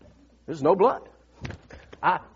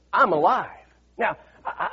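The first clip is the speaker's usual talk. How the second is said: usual speaking voice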